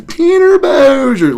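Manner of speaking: high, loud, drawn-out put-on holler; Tanner Bowser shout-out. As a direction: stretch the name out in a loud high voice. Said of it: "I don't know if you guys missed".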